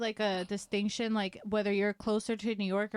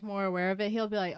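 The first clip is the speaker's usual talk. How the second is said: usual speaking voice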